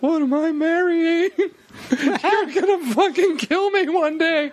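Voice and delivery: high, slow mock wail through laughter; Stacy. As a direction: imitate a dismayed partner, half laughing, half crying. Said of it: "in front of Stacy too. She's like".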